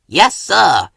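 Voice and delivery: a light little peep of an engine. A narrator's reading. Little engine peeps to the boss. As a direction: answer brightly, like a small eager engine. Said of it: "peeped Thomas".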